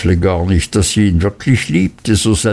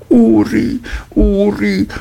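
mother calling out; 11 semitones higher than usual. Acted. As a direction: cry out his name, pleading. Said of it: "rief die Mutter".

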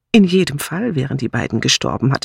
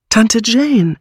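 louder, higher alarmed call of her aunt's name; urgent call; 7 semitones higher, 5 dB louder than usual. A narrator's reading. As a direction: call her aunt back sharply. Bunch calls out alarmed. (laut und besorgt gerufen)

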